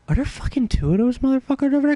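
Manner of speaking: high, quick, incredulous question; mock disbelief. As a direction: ask it in startled disbelief. Retold alarm at seeing wasps.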